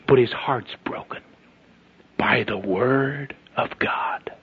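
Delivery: slow, low, hushed reverence. Real, not acted. Preacher telling of a boy broken by the Word, hushed. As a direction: let it sink to a slow near-whisper. Drops to a hush for the point.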